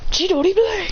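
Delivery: high anime-style technique shout; mock battle cry, pitched high; attack call. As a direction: call out your special move. Calls out an attack move.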